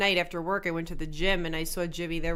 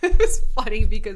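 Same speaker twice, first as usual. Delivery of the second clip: much louder, laughing through the words; 2 semitones higher, 12 dB louder than usual. Real, not acted. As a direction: burst out laughing as you start. Bursting into laughter mid-story.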